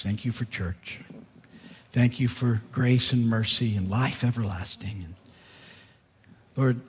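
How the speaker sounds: soft, unhurried closing prayer. Acted. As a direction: pray quietly and sincerely for the church.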